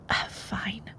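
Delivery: soft whispered concession; whispered give-in. Real, not acted. Conceding to the sleepy listener.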